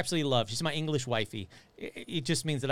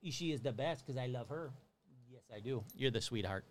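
tender to dog; 3 semitones lower, 11 dB quieter than usual. Real, not acted. Talking tenderly to her dog.

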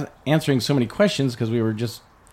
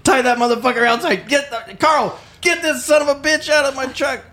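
high, laughing mock shout; laughing yell; 12 semitones higher than usual. Teller ordering Carl to throw the stranger out, laughing yell. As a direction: bark it out while cracking up.

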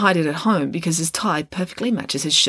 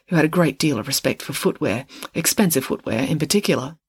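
ordinary delivery

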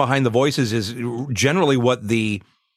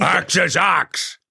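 slow, deliberate, higher put-on warrior voice; Axe voice; 7 semitones higher, much slower than usual. Voice actor slowly growls his character's catchphrase for the host. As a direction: drag each word out like a brute proud of his name. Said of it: "Are we talking about Axe?".

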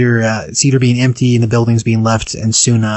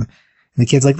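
ordinary talk, nothing marked

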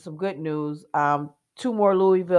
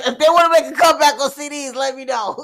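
mock dare; 6 semitones higher, 4 dB louder, faster than usual. Mock challenge to CDs.

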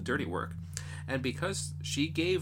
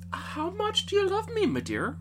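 father asking. Acted. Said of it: "So he says to the third".

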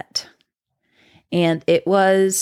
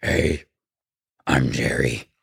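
much lower, eerie demon greeting. Retold or imitated. Retelling the boyfriend's possessed demon voice introducing Jerry, much lower and eerie. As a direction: drop into a spooky possessed voice. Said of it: "talking in a demon voice".

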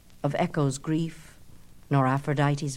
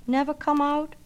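a light echo; 9 semitones higher than usual. Acted. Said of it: "said Echo".

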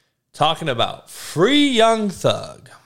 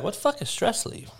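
incredulous retold question; 7 semitones higher, 7 dB quieter, faster than usual. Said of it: "me and my dad would be like".

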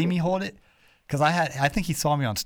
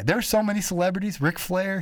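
normal talking voice

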